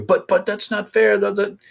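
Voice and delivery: high whiny kid protest, imitated; mock a child whining it's not fair; arguing kid. Arguing teen imitated by parent, whiny high protest. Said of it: "they start to push back and argue".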